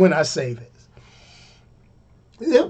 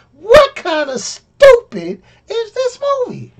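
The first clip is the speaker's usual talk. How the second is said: much higher, slowed, incredulous cry; exasperated disbelief; 4 dB louder, slower than usual. Real, not acted. Reviewer ranting to viewers about the plot, in high incredulous disbelief. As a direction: throw your hands up at the stupidity.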